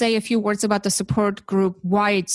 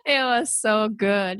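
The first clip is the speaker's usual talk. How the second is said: high laugh turning into tearful laughing words; real laughter; 7 semitones higher, slower than usual. Real, not acted. Host laughing about her guest's teasing, real tearful laughter. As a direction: laugh till it almost cries.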